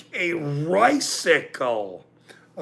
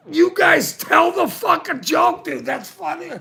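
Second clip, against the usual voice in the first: high, loud exasperated yell at friends; mock-shout the demand to tell the joke; retold yell; 10 semitones higher, 4 dB louder than usual. Retelling how he yelled at his friends to tell the joke, high and loud.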